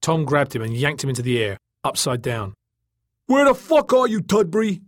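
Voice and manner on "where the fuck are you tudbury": high, loud, outraged yell; angry scream. A narrator's reading. Joey hunts for the boy who grabbed him, angry scream. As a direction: scream it at the one tormenting you. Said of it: "Joey screamed".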